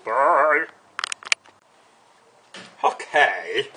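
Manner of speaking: "okay" in a loud Greek accent with a rise; a sudden Greek accent on "okay". Real, not acted. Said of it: "don't know where this Greek accent comes from".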